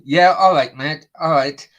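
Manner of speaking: loud, sarcastic "yeah, all right"; sarcastic dismissal. Real, not acted. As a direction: brush off an obvious lie.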